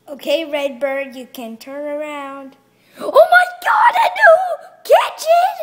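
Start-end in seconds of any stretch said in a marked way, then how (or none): 3.0-5.5 screaming with joy, very high; shrieking jackpot winner. Acted. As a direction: shriek like you won the jackpot. Shrieking at the new kitchen.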